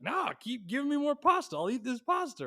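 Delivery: high, eager boast; cocky eager eater. Retold or imitated. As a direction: brag that you can keep eating. Bragging about eating more.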